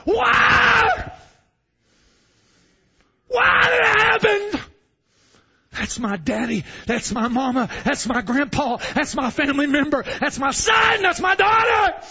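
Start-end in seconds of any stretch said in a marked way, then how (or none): 0.0-4.6 high, loud grieving cry; screaming why. Retold or imitated. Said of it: "they'll scream out". 10.0-12.0 high, louder, slow wail; grieving cry. Retold or imitated. Grieving relatives voiced.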